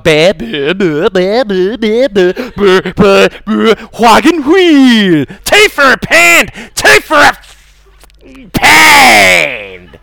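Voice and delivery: hammed-up British accent, building from babble to high shouts; British regional accent. Comic mocks audience's British accents, shouted accent impression. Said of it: "I can't do the accent".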